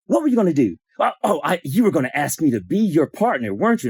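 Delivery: high-pitched mimicked challenge; mock accusatory questioning; accusing. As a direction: imitate an indignant woman calling someone out. Acts out woman's accusing question.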